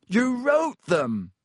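high, loud, slow outraged shout; loud, slow outraged cry. A narrator's reading. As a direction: hurl the accusation at him. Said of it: "Harry shouted".

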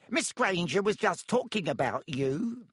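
high, sly tattling whisper-voice. Acted. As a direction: stir trouble slyly. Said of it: "said Peeves slyly".

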